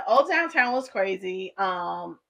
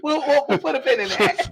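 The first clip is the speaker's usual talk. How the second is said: laughing; 4 semitones higher, 4 dB louder than usual. Real, not acted. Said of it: "That's a little inside joke".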